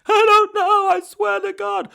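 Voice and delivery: high, loud panicked shout; terrified thug. Retold or imitated. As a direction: plead like a scared thug. Terrified thug answering Batman.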